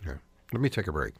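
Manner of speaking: ordinary talk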